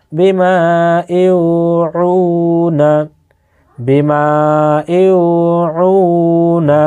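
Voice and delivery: slow, drawn-out chanting recitation; Quran recitation. Acted. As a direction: recite each word slowly, holding long vowels.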